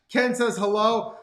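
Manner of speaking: ordinary talk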